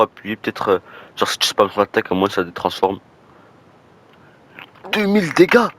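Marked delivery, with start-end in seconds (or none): 4.9-5.7 much higher and louder, amazed; excited shout. Real, not acted. Gamer seeing two thousand damage, amazed excited shout. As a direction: yell the number in disbelief. Huge damage number appears.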